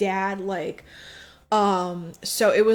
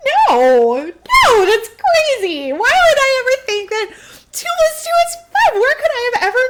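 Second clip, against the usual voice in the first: high squeaky mock disbelief, loud and laughing; mock incredulous Montag; 11 dB louder than usual. Retelling Montag denying two plus two is five, hammed-up high squeal. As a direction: squeal it like an outraged cartoon. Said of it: "And he's like".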